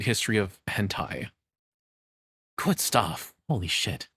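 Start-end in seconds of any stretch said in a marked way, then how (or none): none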